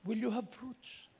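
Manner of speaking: slow, drawn-out preaching, swelling on Here am I; preaching challenge. Acted. Preacher asks the congregation if they bear fruit, slow and earnest. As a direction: lean in and challenge the flock slowly.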